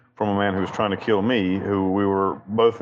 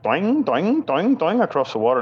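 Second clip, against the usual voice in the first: much higher, springy boing sounds; imitating the seaplane skipping across the water, cartoon style; cartoon bounce noises; 9 semitones higher than usual. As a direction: bounce it like a Wile E. Coyote plane skipping. Plane bouncing like Wile E. Coyote.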